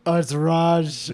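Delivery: slow, lightly put-on cartoon accent; drawn-out mock Indian-style accent; King Julian accent. Customer giving a name at the box office, in a put-on accent. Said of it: "This is King Julian".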